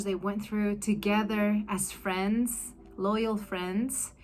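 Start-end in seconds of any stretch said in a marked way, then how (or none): none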